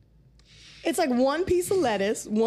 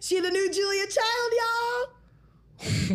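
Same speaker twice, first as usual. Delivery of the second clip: hyped up; 9 semitones higher, slower than usual. Real, not acted. Hyping friend's cooking show.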